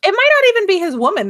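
excited objection. Real, not acted. Sudden high fast objection.

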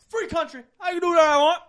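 high, very loud retold protest; defiant yelling. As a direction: holler back at the cop in mock defiance. Retold man defies the cop.

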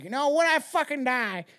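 mock ghost threat. Bellman ghost threatening the hotel guests, mock spooky voice. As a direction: play the dead bellman swearing he'll haunt you.

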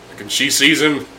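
ordinary delivery

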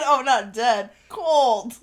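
loud, giggling retelling; laughing mock quote. Friend teasing her mixed-up idiom, laughing loudly. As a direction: mimic her slip through laughter. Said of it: "he said".

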